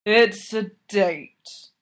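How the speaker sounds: put-on slow, slurred drunk voice; drunken slur. A narrator's reading. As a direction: drag the words out, tipsy and slurring. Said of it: "Well, he slurs it".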